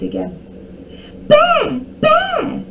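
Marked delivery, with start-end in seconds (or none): 1.3-2.6 very high, loud false alarm; loud cry. A narrator's reading. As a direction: scream the warning to the town. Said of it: "cried as loudly as she could".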